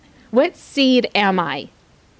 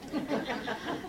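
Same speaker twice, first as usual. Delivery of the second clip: breathy laugh; 7 semitones higher, 15 dB quieter than usual. Real, not acted. Laughs at own joke.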